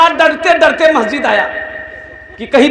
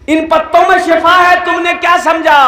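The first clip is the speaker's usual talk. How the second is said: higher, louder voice from above; booming divine rebuke; 6 semitones higher, 3 dB louder than usual. Acted. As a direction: call it down like a voice from heaven. Voice from the unseen rebukes Musa. (गैबी आवाज़ की ऊँची डाँट)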